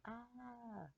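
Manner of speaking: high, soft imitated woman's "Ah"; a faint high cry like a distant woman; mock female cry. Retelling the coyote clip to a friend, soft high mock cry. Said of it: "a female going".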